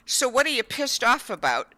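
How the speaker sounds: patient imitating her doctor's bluntness; blunt doctor. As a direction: deliver the doctor's frank challenge. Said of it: "He looks at him and goes".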